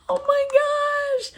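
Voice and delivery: high, slow squeal of delight. Real, not acted. Spotting a paw on the blush, squealing. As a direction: stretch it out in a thrilled squeal.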